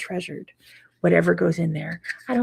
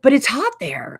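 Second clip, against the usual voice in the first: mock disbelief; 7 semitones higher, 5 dB louder than usual. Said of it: "They're like".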